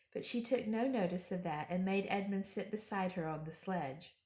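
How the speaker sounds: plain reading voice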